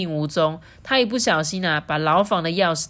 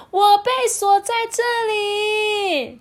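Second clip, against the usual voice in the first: princess voice; 5 dB louder, slower than usual. Acted. 公主求救.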